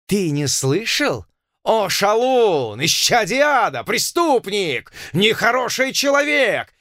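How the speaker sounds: high, slow outraged planter; furious colonel. Acted. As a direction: spit out the insults slowly. (разъярённый полковник)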